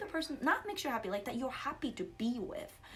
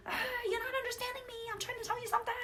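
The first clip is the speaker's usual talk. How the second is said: high, very fast worked-up protest; raised-voice frustration; 12 semitones higher, much faster than usual. Retold or imitated. Worked-up woman arguing with boyfriend, high frantic protest. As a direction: rush it out high, desperate to be understood. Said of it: "raise my voice".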